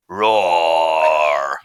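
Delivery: low, loud, drawn-out roar; loud bear roar. Acted. Sound effect played back for the class, loud low roar. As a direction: give a big hungry-bear roar.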